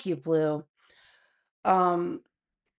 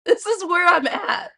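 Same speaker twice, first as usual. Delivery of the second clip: said through a laugh, higher and louder; giggling admission; 11 semitones higher, 6 dB louder than usual. Real, not acted. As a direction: laugh it out at yourself. Laughing at herself.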